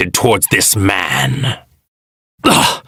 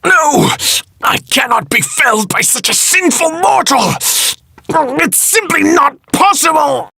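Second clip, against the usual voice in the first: shrieking disbelief much higher; furious denial. Acted. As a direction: scream refusal at your defeat. God defeated, in denial.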